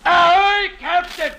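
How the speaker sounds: loud high frantic hail; loud desperate ahoy; shouted plea. Acted. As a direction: yell up to the ship, begging. Calling to ship, pleading.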